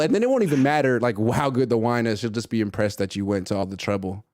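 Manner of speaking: ordinary delivery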